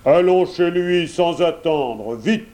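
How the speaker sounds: a touch of wolfish impatience; eager wolf. A narrator's reading. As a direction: urge him to hurry, as the wolf. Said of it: "proposa le loup".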